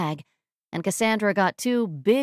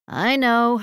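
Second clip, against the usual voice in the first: higher, louder, emphatic agreement; exasperated agreement; 4 semitones higher, 5 dB louder than usual. Acted. Exasperated agreement about his crush.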